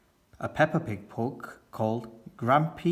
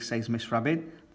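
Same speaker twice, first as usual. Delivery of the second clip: plain reading voice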